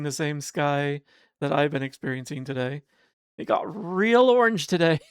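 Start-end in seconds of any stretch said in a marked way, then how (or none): 3.4-5.1 laughing through the words; giggling while telling it. Real, not acted. Laughing about orange sky.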